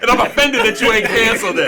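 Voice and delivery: high, loud playful outrage; mock offended. Teasing her friend, mock offended. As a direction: fake indignation.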